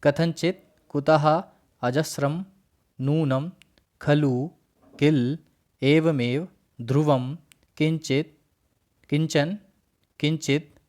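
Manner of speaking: each word said separately and clearly, with a pause after it; reciting Sanskrit words. Shown as a demonstration. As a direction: recite the word list carefully for the class. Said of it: "उच्चारण कर लेते हैं". (अव्ययों का धीमा, स्पष्ट उच्चारण)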